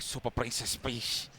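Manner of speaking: put-on heavy Dominican accent, slow. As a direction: imitate a Dominican friend's accent. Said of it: "that heavy Dominican accent".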